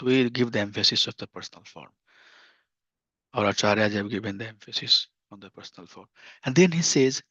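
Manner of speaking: ordinary talk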